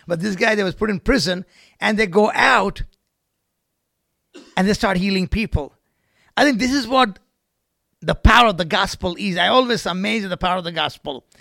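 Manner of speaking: loud pulpit proclamation; emphatic preaching. Real, not acted. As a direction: drive the point home to the congregation. Preaches at full force.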